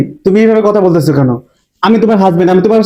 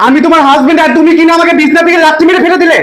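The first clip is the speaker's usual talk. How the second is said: high, raised aggrieved voice; indignant protest; 9 semitones higher, 3 dB louder than usual. Acted. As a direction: protest the outrage at full pitch. Husband protests being kicked out.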